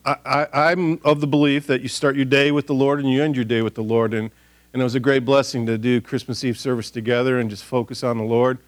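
ordinary delivery

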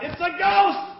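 high, loud terrified cry; cried out in fear. Acted. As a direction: shout it like you see a ghost. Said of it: "they cried out".

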